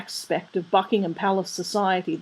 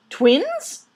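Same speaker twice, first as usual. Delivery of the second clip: high rising startled query; taken aback, pitch jumping up; startled question; 11 semitones higher, 5 dB louder than usual. A narrator's reading. Father hears of twins, startled.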